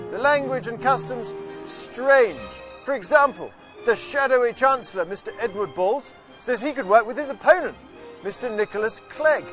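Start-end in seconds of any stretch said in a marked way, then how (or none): none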